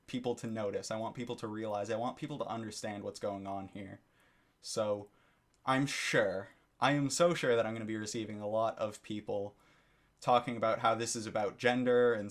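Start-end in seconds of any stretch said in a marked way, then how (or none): none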